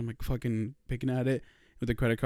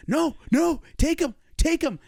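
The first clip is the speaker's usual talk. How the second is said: high-pitched mock whining insistence; retold petulant insistence; 4 dB louder, slower than usual. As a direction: imitate a sibling throwing a fit, pitched way up. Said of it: "He's just being a little bitch".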